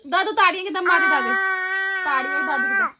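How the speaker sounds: loud coaxing. Real, not acted. Asking toddler loudly over crying.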